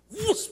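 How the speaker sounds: high, loud whoosh of a passing car; imitated whoosh. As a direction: whoosh like a car cutting past. Imitates car whizzing past. (tiruan bunyi mobil melesat)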